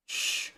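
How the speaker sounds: soft drawn-out shh; librarian shushing whisper. Retold or imitated. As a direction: hush someone like a librarian. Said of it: "Do you shush me?".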